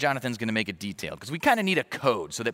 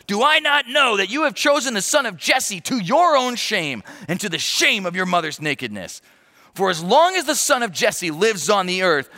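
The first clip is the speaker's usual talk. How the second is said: high, loud raging tirade; furious king; 8 semitones higher, 7 dB louder than usual. Acted. Saul raging at Jonathan for siding with David, loud and high. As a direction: rage at a disloyal son.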